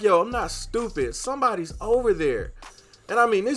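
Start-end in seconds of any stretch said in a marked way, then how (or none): 0.0-2.5 retold accusation, pitched high; indignant confrontation. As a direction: insist in a raised, higher pitch. Acted confrontation with girlfriend.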